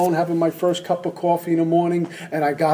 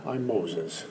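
very low, soft and solemn; mock holy voice; 9 semitones lower, 11 dB quieter than usual. Alcoholic posing as spiritual, deep mock-solemn intoning. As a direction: intone it like a prophet. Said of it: "so the voice goes down to about here".

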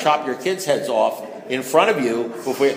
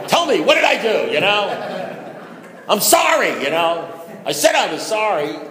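high, loud whiny begging; man desperate to be liked, high loud whiny apology; desperate pleading; 7 semitones higher, 4 dB louder than usual. Retold or imitated. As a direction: beg for them to like you, pitched way up. Needy pleading to the enemy.

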